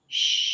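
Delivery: Shh with a long puff of air; Shh, held long. Shown as a demonstration. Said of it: "a long sound with a long puff of air".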